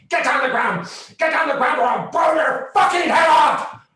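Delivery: very high, very loud shouted threat; cop screaming threat. Retold or imitated. Cop screaming at kids in a field to get down, very high and loud.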